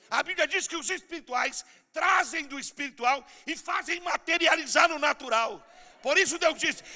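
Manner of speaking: shouted, climbing sermon, half sung at the end; full-force preaching. Acted. Preacher roars a declaration over the church, shouting then singing. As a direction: build to a roaring, chanted declaration.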